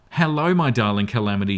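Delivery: plain reading voice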